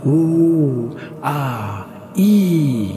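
held vowels climbing to a loud, high cry; wailing chant. A narrator's reading. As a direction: wail each vowel like haunting voices. Chorus of voices reaches peak.

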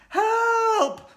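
very high, loud cry for help; panicked yell. A narrator's reading. Kid fleeing the classroom, yelling. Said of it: "some kid yelled".

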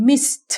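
"missed" ending in a crisp t after s; puffed final t. Shown as a demonstration. Teacher shows the ed rule, puffed final t. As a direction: puff a t straight after the s in "missed". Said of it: "เราจะพ่นลมตามออกไปทันที ก็คือ th".

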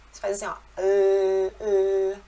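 ê held with the tongue behind the teeth. Shown as a demonstration. Teacher demonstrating where the tongue sits. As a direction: show the tongue spot on ê. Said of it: "a língua vai atrás dos dentes".

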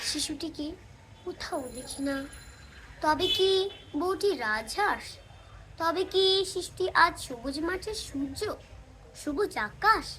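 ordinary talk, nothing marked

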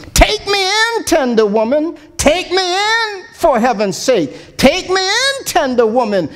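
high lilting plea on each "Take me in"; snake sighing refrain. Acted. Snake pleading to the tender woman, high lilting refrain. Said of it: "sighed the snake".

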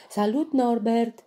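high, loud call; shouting greeting. A narrator's reading. Tafiti calling to the rhinoceros, loud shout. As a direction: call out across the path. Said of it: "strigă Tafiti".

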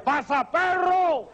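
high-pitched, drawn-out cry shooing off a dog; acted holler at a dog; stuck man's yell. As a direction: stretch the words out in a desperate high call. Man stuck yells at dog.

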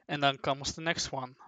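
usual speaking voice

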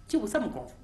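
plain reading voice

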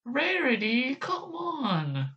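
high, squeaky pleading character voice; Sweetie Belle. Acted. Attempting Sweetie Belle calling to Rarity, high-pitched whine. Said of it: "Sweetbell's voice here".

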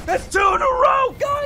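high, loud angry outburst; frustrated shout. Real, not acted. Gamer fed up after two grenade deaths, angry shout. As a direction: yell it at the screen.

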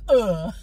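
a loud, short groan of someone hitting the ground; imitated groan. Brother falling while running with the camera, groaning. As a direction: grunt like you just fell down a hill. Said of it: "and he goes".

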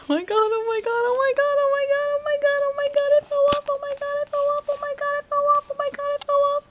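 high, loud frantic repeating; real panic. Real, not acted. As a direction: panic as the camera falls. Camera fell, panicking.

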